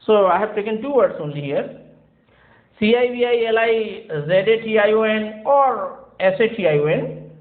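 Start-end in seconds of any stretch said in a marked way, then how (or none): none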